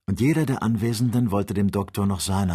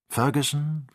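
plain reading voice

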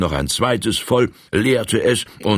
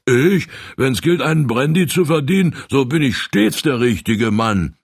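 eager; 9 semitones higher than usual. Acted. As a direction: jump at the chance to earn a brandy. Stoker eagerly volunteers. (der Heizer drängt sich eifrig vor)

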